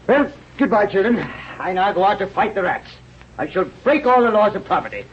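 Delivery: high, swaggering battle boast; gleeful rant. Acted. Rat killer boasting to the children, high gleeful swagger. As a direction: crow about the slaughter to come.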